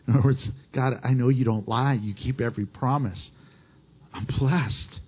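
hushed humble prayer. Acted. David praying to God, overwhelmed and grateful, hushed and low.

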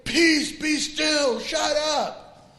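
high, slow, commanding "Peace, be still, shut up"; commanding the storm. Pretend. Pastor tells congregation to hush a storm, high slow command. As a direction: order the storm to hush like a dog. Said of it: "And then say".

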